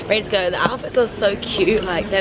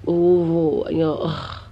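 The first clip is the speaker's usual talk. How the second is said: normal talking voice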